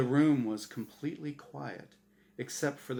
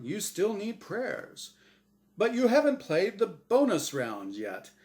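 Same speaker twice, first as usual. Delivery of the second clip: chipper, higher host voice; game show host Azrael; 3 semitones higher than usual. Acted. Azrael pressing Norm to play, bright host voice. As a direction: needle him like a smug quizmaster.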